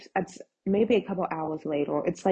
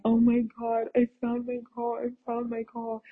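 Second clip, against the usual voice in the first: giddy excitement; 5 semitones higher than usual. Retold or imitated. Reacting to a perfect car listing, giddily excited. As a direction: gush in a high little voice. Retelling thrill at finding a car.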